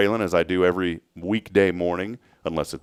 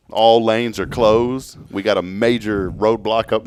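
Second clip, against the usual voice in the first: mock traffic report; 4 semitones higher, 7 dB louder, slower than usual.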